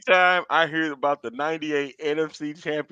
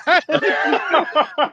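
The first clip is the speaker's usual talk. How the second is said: high burst of laughter; loud laugh; 10 semitones higher, 3 dB louder than usual. Real, not acted. Co-host cracking up at the rant, loud laughter.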